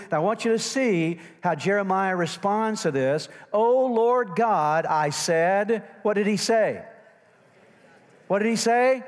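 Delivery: loud, high sermon delivery; full-force preaching. Real, not acted. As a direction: preach it out to the whole congregation. Preaches to the congregation.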